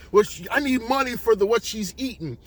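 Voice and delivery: high, heated demand; owner demanding payment. Acted.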